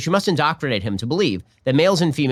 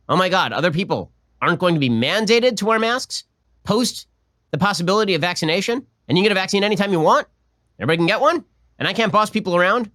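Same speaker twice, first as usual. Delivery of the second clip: higher-pitched, exasperated parody of complaint; mock outrage; 5 semitones higher than usual. Host parodies a complaint about unmandated masks, in mock outrage. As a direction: play up indignant disbelief as an imitation. Mocking the Texas clip's outrage.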